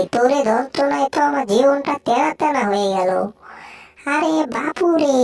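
ordinary talk

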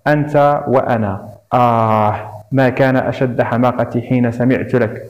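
ordinary delivery

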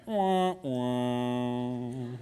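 soft mock "wah wah" crying; a babyish fake whimper; preacher mimicking Debbie Downer, mock wailing. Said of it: "you can just picture Debbie Downer".

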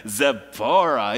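Zipporah drawn out very slowly and loud; savored name. Shown as a demonstration. Preacher showing how to read Zipporah, slowly savoring. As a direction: linger on Zipporah like it tastes good. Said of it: "when you read that, it's like".

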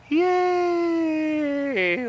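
high, long drawn-out cheer; cheering a pet. Real, not acted. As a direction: stretch out a high celebratory coo. Cheering the pet's success.

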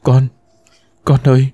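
a touch of a choke as she calls her son; mild catch in the throat, tearful; choked voice. A narrator's reading. Mother finally holding her lost son, lightly choked. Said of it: "giọng nghẹn ngào".

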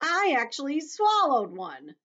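high, loud, slow proud boast; declared proudly. A narrator's reading. Earthworm boasting to the others, high and proud. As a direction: announce it proudly, stretching it out. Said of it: "the earthworm declared proudly".

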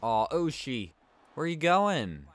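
loud, high disbelieving call; loud high where-are-you-going cry; surprised. Real, not acted. Teammate skates off wrong way.

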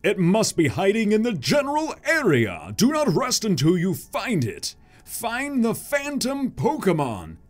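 slow, drawn-out orders to minions; commanding villain boss. Acted. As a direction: give orders like a cartoon villain.

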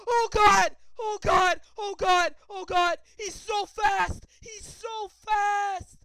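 high, loud strained screams; terrified screaming. Real, not acted. Player fleeing the fast monster, screaming in terror. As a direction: scream for your life. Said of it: "I'm losing my voice".

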